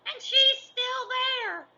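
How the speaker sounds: very high, slow drawn-out squeal; baby bear squeak. A narrator's reading. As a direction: stretch it out in a tiny shrill bear voice. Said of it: "squeaked the baby bear".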